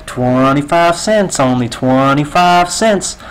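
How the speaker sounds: slow, robotic sales line; brainwashed cashier. Retold or imitated. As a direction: recite it slowly like a script. Brainwashed cashier facing robber.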